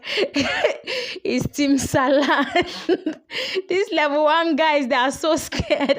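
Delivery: high, giggly, words breaking into laughter; laughing while talking. Real, not acted. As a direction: giggle helplessly through the words. Amused by the team she discusses.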